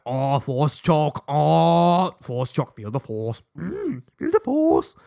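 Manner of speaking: slow, drawn-out mock villain; Force-choker impression. Schoolboy mocking a regular force choke, slow theatrical voice. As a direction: stretch the words, hammed-up dark powers. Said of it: "this isn't, like, some, like, regular".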